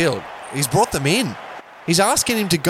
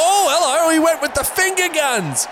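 excited; 11 semitones higher than usual. Real, not acted. Excited catch commentary.

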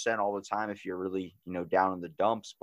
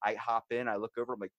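normal talking voice

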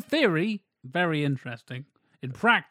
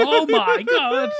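very high, slow, drawn-out squeal; delighted oh my god; 7 dB louder, much slower than usual. Real, not acted. Cohost reacts to a gasp, high slow giddy squeal. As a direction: stretch it out in giddy disbelief.

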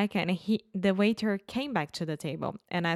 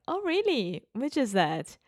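high-pitched retold "oh, really"; swooping, intrigued question; surprised interest; 5 semitones higher than usual. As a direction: act out curious surprise. Retelling own reaction to the waiter.

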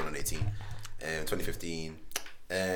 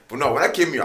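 ordinary delivery

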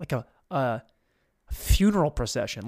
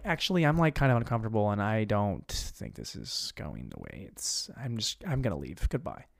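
lower, softer, faltering; uncomfortable girl; 7 semitones lower, 6 dB quieter than usual. Retold or imitated. Girl excuses herself from the radio call, soft uneasy imitation. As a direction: back away quietly, imitating her discomfort. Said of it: "And then she goes like".

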